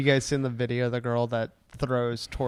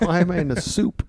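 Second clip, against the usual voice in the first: mock turtle voice; 4 semitones higher than usual. Joking turtle voice.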